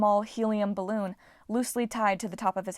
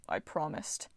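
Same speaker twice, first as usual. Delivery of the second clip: soft, low murmur; 4 semitones lower, 8 dB quieter than usual. A narrator's reading. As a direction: murmur it sadly. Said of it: "he murmured".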